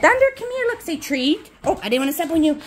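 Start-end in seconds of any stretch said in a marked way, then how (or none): 0.0-0.6 high sing-song call to the dog; high, coaxing call to Thunder. Real, not acted. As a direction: call him over, pitched up high. Calling the dog.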